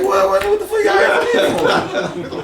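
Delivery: very high, laughing disbelief; stunned disbelief. Retold or imitated. Answering the caller in disbelief, high and laughing. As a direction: sputter it out, amazed and laughing.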